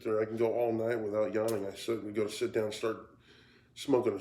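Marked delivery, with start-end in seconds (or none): none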